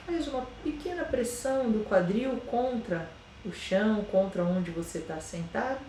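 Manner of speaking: slow, soft meditation voice with long pauses; guided meditation. Acted. Yoga teacher guiding listeners through a body scan, slow and soft meditation voice. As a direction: ease them into calm, one body part at a time.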